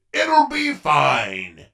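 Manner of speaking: ordinary talk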